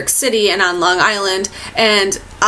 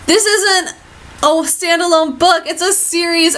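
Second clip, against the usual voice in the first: pitched well higher, a mock panicked realization; retold shock; 7 semitones higher than usual. Reader realizing the book is a series, mock panic. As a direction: gasp it out as if just realizing the book won't end. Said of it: "And I realized".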